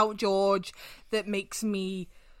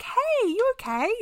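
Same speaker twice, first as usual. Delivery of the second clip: higher bright hey; chirpy girlfriend; 13 semitones higher than usual. Retold or imitated. Girlfriend picking up the phone, higher and cheerful. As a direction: pitch it up like a cheery answer.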